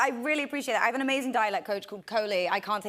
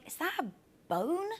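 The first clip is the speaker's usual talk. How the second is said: hushed worry; 4 semitones higher, 6 dB quieter than usual. Retold or imitated. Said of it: "I'm like".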